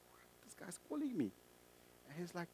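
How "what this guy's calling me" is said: acted whispery aside, very soft; hushed disbelief. Man puzzled that his offender is phoning him, hushed disbelief. Acted private surprise at a call.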